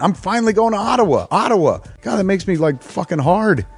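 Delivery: excited. Acted. Hyped about finally visiting Ottawa.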